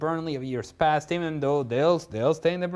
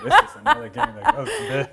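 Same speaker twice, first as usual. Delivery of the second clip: laughing mockery; 12 semitones higher, 5 dB louder than usual. Real, not acted. Mocking a dull fixture.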